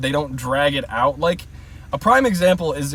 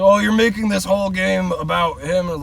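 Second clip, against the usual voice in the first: higher-pitched mocking imitation of critics; mock complaint; 5 semitones higher than usual. Imitating critics who object to the game, mock complaint. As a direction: parrot the complainers in a raised mock voice.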